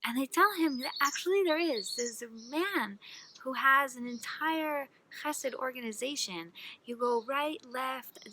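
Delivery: usual speaking voice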